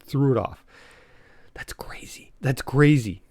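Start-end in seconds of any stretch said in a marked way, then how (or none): 1.5-2.3 whispered, almost voiceless aside; stunned, very soft whisper; hushed disbelief. Real, not acted. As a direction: whisper it under your breath in disbelief. Stunned repeat of monkey war story.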